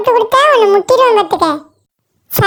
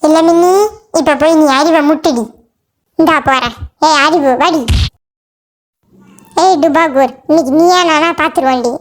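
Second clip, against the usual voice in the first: low-pitched, confident comic animal character; challenging fighter; 7 semitones lower than usual. Acted. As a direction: throw down a challenge in a lowered voice. (சவால் விடும் தாழ்ந்த குரல்)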